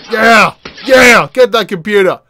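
loud whooping encouragement; punchy, loud cheer; excited cheering. Real, not acted. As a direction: shout it at the screen, egging him on. Cheering on computer smashing.

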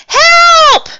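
very high, loud drawn-out cry; scream for help. A narrator's reading. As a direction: shriek for rescue in a storybook voice. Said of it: "screamed the driver".

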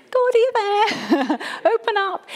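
mock a polite, timid knock, high and laughing; timid little knock. Shown as a demonstration. Preacher mocks a polite little knock at God's door, high and timid. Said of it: "is not a nice little".

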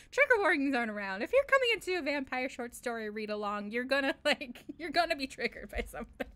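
loud laughing exasperation; laughing rant. Real, not acted. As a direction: laugh through the complaint. Laughing rant about trigger warnings.

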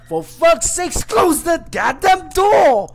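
a raging chef yelling to close the door, furious shout; angry chef yell. Acted. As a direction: roar at them to shut the door. Chef yells again.

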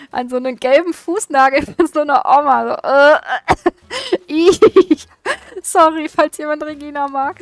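laughing. Real, not acted. Apologizing to fans of Regina while laughing. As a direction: giggle at your own rudeness.

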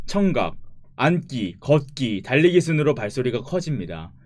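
loud, announcer-like read-out; tutorial read. Acted. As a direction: read the game tips like an announcer. Reads the game tutorial aloud.

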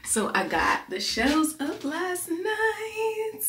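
high, slow, drawn-out singsong; proud, excited reveal; singsong showing off. Real, not acted. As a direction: stretch it out and climb to a long high note. Proudly showing off new shelves.

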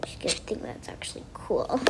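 soft unvoiced whisper, plotting; sneaky whisper to herself; whispered aside. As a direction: hush it like a secret plan. Scheming aside while adding something.

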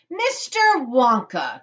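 drawn-out, high, loud exclamation; crying out. A narrator's reading. Mrs. Salt interrupting Mister Wonka, crying out. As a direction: call the name out slowly and sharply. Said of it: "cried Missus Salt".

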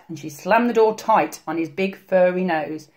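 ordinary delivery

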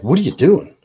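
wife catches husband posing at the gym, sharp suspicious question. Retold or imitated. Wife confronts him at gym.

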